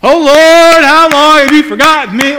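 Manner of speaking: a wailing psalm line; loud lament. Retold or imitated. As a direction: call out as if forgotten by God. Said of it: "this is a song that they used to sing".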